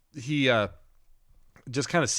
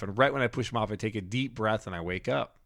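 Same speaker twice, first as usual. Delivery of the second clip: ordinary talk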